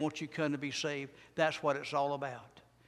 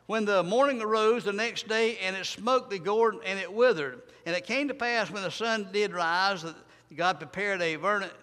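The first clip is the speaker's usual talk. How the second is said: loud, high preacher's scripture reading; preaching scripture; 4 semitones higher, 7 dB louder than usual. Acted. Preacher reading Jonah to his church, loud and rising. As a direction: proclaim the verses to the congregation.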